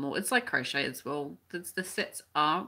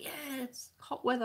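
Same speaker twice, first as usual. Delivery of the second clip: mock delight; 7 semitones higher, 4 dB quieter than usual. Crocheter imagining a local greeting the heat, mock delighted. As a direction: act out being thrilled, voice lifted. Voicing delight at first hot days.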